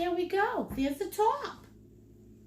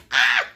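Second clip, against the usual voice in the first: short loud low cry of glee; excited squeal; 7 dB louder than usual. Real, not acted. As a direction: let out a burst of delight. Excited about her hair appointment.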